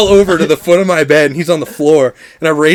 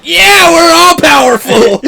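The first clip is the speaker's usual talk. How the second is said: high, loud, stretched-out cheer; mock triumphant yell; 10 semitones higher, 6 dB louder, slower than usual. Friend reenacting everyone feeling powerful, triumphant yell. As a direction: crow it like a hammed-up war cry. Retold triumphant cry of the group.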